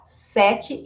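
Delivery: "sete" with a wide first eh; open E. Shown as a demonstration. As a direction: say "sete" as SEH-chee. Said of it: "este E aqui também é aberto".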